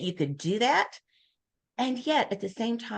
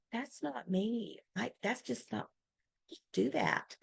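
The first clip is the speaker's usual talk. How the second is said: quiet; 2 semitones higher, 12 dB quieter than usual. Retold or imitated. Shy person quoted saying not me.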